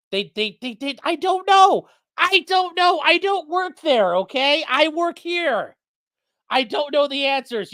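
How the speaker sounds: high, slow exasperated protest; mock-exasperated pitch way up. Real, not acted. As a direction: throw up your hands, you don't work there. Exasperated at chat questions.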